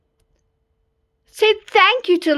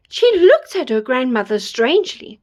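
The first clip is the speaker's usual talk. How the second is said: ordinary delivery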